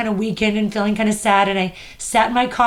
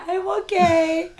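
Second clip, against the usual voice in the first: high, slow tearful squeak; drawn-out mock-weepy brave face; smiling through tears; 8 semitones higher, slower than usual. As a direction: imitate crying while insisting all is fine. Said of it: "I'm trying to smile through it".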